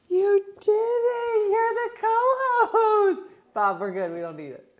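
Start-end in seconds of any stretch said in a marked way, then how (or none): 0.1-3.2 much higher, louder and slow, tearful praise; overjoyed, near tears. Real, not acted. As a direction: gush over her, choked with joy. Praising pet after success.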